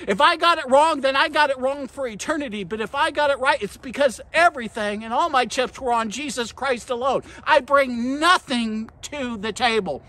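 impassioned sermon delivery, key words punched; full-force preaching. Acted. Preacher warning the congregation against pride, full-force preaching. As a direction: drive each point home to the congregation.

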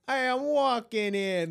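high, loud, drawn-out vlogger voice; influencer voice. Retold or imitated. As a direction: imitate a chirpy TikTok influencer. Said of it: "he goes".

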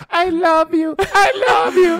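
very high, stretched toy-doll voice; high squeaky stuffed toy saying I love you; talking teddy voice. Retold or imitated. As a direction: squeak it out slowly like a broken talking plush toy. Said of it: "el peluche".

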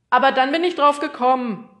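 loud, high, triumphant postman; proud postman. Acted. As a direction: announce it proudly at full voice. Proud postman announces his solution.

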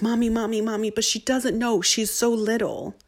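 put-on high-pitched little kid's plea; imitated pleading child. Son defending his little sister to mother, high and pleading. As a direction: beg Mommy to go easy, in a child's voice.

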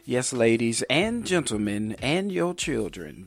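ordinary delivery

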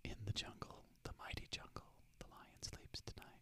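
near-silent breathy whisper, almost just mouthing; almost don't say it, just breathe; faint mouthed whisper; barely audible whisper. Shown as a demonstration. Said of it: "almost like, don't even say it".

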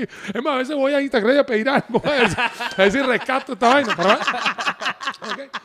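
ordinary talk, nothing marked

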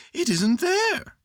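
bright little bear asking kindly; high cheerful Pooh Bear; cheery Pooh. A narrator's reading. Pooh's voice.

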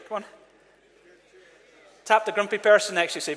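normal talking voice

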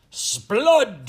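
loud, high outraged royal cry; awful cry. A narrator's reading. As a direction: explode in outrage as the Queen. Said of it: "she cried with an awful voice".

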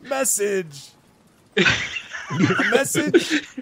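high-pitched, drawn-out goblin herald; comically persistent messenger; goblin envoy, insistent. Acted. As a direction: prompt them in a high, stretched-out voice.

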